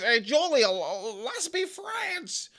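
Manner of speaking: high, slow, sugary mock-friendly voice; mock sweet Roxanne. Wrestler to Julia, high mock sweet offer of friendship. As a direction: hams up a fake-nice invite. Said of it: "Roxanne Perez says".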